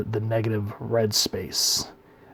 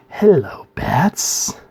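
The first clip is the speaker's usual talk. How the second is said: higher, louder Joker sneer; creepy Joker; 6 semitones higher, 4 dB louder, much slower than usual. Retold or imitated. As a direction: greet Batman like a creepy Joker. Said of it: "creepy look, and he goes".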